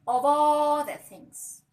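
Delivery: loud, slow, stretched opening; emphatic drawn-out call. Real, not acted. Advising women about jobs, a loud stretched emphasis. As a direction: draw it out big to grab attention.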